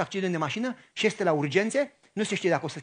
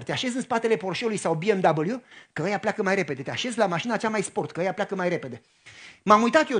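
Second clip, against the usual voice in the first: ordinary talk, nothing marked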